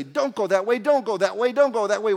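loud, high, driving repetition; insistent nagging warning. Acted. Acting out what he doesn't say.

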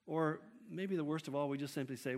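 normal talking voice

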